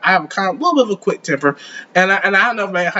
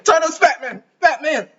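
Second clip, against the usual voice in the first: high mimicking cartoon voice; high-pitched mock demand; Stewie; 7 semitones higher than usual. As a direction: echo the heckler in a high caricature. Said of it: "He sounds like Stewie".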